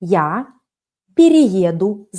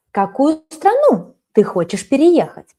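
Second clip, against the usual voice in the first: normal talking voice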